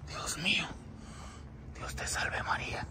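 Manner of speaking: very soft, breath-only plea to heaven; whispered prayer. Real, not acted. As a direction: whisper it to yourself. Praying under breath in dread. (plegaria susurrada)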